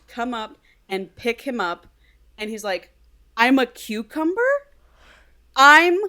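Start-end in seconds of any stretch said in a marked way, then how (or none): 3.4-4.4 higher-pitched, incredulous question; mock bewildered realisation; acted disbelief. Character discovers he is a cucumber. 5.6-6.0 loud, high, drawn-out incredulity; hammed-up shocked outcry; mock outraged disbelief. Same discovery repeated, more outraged.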